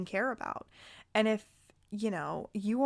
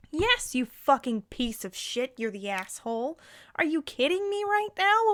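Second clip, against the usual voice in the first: real outraged rant, punching key words louder; fired up; 4 dB louder than usual. Real, not acted. Answering a bride who asks if she's the asshole, fired up. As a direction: lay into them, indignant and emphatic. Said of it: "got a little fired up".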